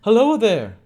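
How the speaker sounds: loud, high call from above. A narrator's reading. Man calling down from an upper door, loud high call. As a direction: shout up from a window, high and loud. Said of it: "cried a voice from above".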